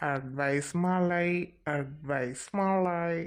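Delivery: very slow, stretched syllables; drawn-out muttering. Acted. Student grumbles about teacher's long advice, slow drawn-out mutter. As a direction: drag each word out under your breath.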